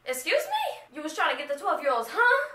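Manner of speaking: high, loud, fast incredulous squeal; mock shocked. Talking back to the ride guy who wanted her number, shocked and high. Reacting to creepy ride operator.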